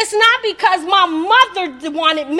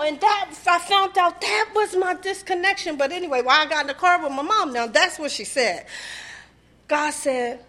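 ordinary delivery

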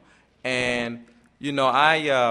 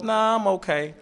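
mother's voice; 8 semitones higher than usual. Retold or imitated. Mother declines the flowers.

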